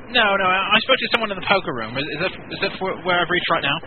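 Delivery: put-on a lightly done English accent as an old army colonel; British colonel accent. Prank caller ringing the casino as an English colonel, light British accent. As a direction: play the stiff English colonel, barely put on.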